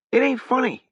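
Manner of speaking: high, hurt protest. Acted. Harrogate protests to Sutri, high voice. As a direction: pitch up and insist. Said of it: "Sutri laughed".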